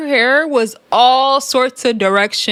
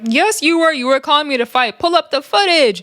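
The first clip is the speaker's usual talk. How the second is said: higher, mock indignant comeback; imitating an indignant retort; 6 semitones higher than usual. Erica Raven telling Rella G she was calling her to fight, high mock retort. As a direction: snap it back, insisting.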